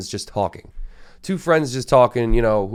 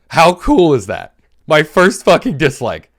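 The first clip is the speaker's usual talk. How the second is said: excited; 7 semitones higher, 8 dB louder, slower than usual. Real, not acted. Podcaster gushing to listeners about his first dislike, excited. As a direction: pitch jumps up, louder and slower, thrilled.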